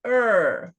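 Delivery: "er" alone, loud and drawn out; isolated sound. Shown as a demonstration. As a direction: demonstrate a long, falling "er". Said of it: "essa palavra aqui".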